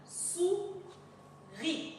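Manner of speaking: "Souris" as high "sou", long pause, then "ri"; syllable by syllable. Shown as a demonstration. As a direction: break "Souris" into two isolated syllables. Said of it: "Deux syllabes".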